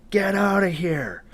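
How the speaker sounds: high, strained mock yell; held-back imitation of a full-lung shout; retold yell. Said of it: "he just yelled at the top of his lungs".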